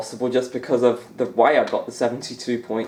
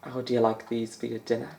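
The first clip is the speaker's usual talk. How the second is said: softer, murmured Scottish commentator catchphrase; quiet McManus impression; 7 dB quieter than usual. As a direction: mutter the catchphrase in a faint Scottish lilt. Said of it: "as Alan McManus would say".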